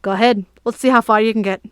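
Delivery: mildly mocking knight's taunt; smirking dare. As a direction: knight daring the boy to run, smirking. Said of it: "and smirked at him".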